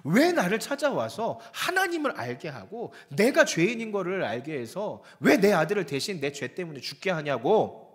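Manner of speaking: loud, high, anguished accusation; frantic crying out. Acted. Widow screaming at Elijah over her dead son, loud and anguished. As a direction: cry out at him like a grieving mother gone wild. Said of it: "막 미친 듯이 부르짖는 거예요".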